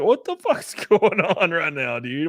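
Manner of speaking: smirk nervously through the question; retold baffled chuckle, drawn out. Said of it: "nervously smirking".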